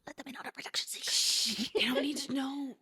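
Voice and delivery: hushed stage whisper. Pretend. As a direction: whisper it like a secret. Hushing the production secret.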